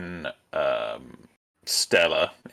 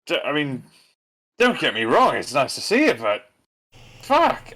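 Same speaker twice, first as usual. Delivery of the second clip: higher, stammering then a yelped curse; flustered; 7 semitones higher than usual. Acted. Trent flustered at the surprise visit, stammering. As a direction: torn between pleased and rattled. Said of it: "he's like".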